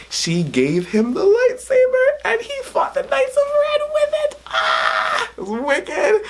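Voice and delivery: rising into a very high squeal; excited shriek. Real, not acted. Fan telling viewers about the lightsaber fight, wildly excited. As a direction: gush until the voice goes into a shriek.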